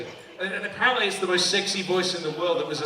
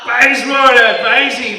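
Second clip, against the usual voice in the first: loud, higher cheer back to the crowd; excited call-out; 6 semitones higher, 13 dB louder, slower than usual. Real, not acted. Comic greeting an audience member's suburb, excited call-out. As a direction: shout it out, then ease off.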